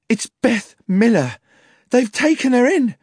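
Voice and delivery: high, loud panicked outburst; frantic young woman. A narrator's reading. Young woman telling the landlord of an arrest, frantic and shrieking. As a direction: cry out the terrible news. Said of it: "came screaming into the bar".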